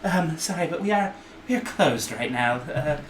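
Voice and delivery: high, flustered little gnome woman; nervous shopkeeper shooing people out. Acted. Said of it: "gnome woman's like".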